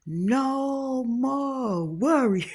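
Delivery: very slow, stretched-out repeat; repeating the cook. Retold or imitated. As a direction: draw "worry" out, slow and solemn. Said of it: "So I had to do like this".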